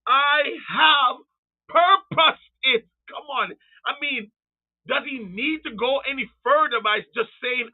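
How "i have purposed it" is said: loud, high proclamation in slow beats; Creator speaking. Retold or imitated. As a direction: thunder it out word by word. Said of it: "This is the Creator speaking".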